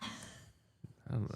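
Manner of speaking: soft whispered aside; whispering. Real, not acted. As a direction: whisper it, almost silent.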